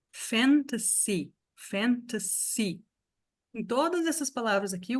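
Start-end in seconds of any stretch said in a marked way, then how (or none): 0.1-2.8 fantasy drawn out slowly with a hard S; slow English demo. Shown as a demonstration. Teacher slowing fantasy so students hear the S. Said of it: "o S tem som de S forte".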